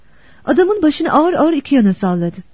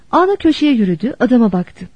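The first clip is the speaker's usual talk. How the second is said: ordinary delivery, nothing marked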